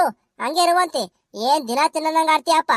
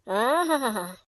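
contented relishing aha; 5 semitones lower, 5 dB quieter, much slower than usual. Acted. Wife relishing festival dumplings.

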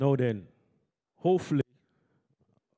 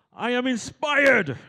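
loud, high, drawn-out rallying cry; energetic call; 10 semitones higher, 7 dB louder, much slower than usual. Shown as a demonstration. Host leads audience in a chant, energetic call. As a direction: belt it out slowly to rouse the room. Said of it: "we need some more energy".

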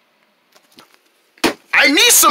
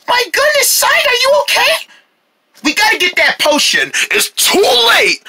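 high worried voice. Acted. Worried friends at unconscious Saig.

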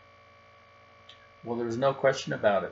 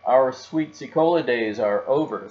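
louder, decisive mother; firm declaration; 2 semitones higher, 8 dB louder than usual. Acted. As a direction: lay down the law. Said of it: "said Mama".

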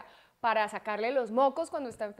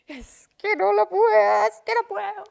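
mock meltdown; 10 semitones higher, 12 dB louder than usual. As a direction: wail it like an over-the-top tantrum. Acting out own meltdown at table.

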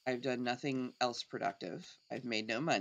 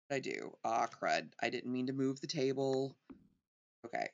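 ordinary delivery

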